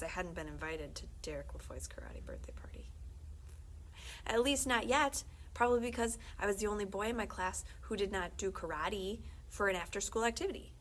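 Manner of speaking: usual speaking voice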